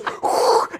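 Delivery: breathy voiceless whoosh of ignition; mouth-made sound of sparklers going up; imitated firework whoosh. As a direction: blow out a sudden rushing whoosh.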